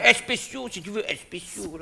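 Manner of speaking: "spéciaux" with an extra eh in front; stubborn regional pronunciation. Shown as a demonstration. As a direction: say "spéciaux" as es-péciaux. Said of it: "on dit spéciaux".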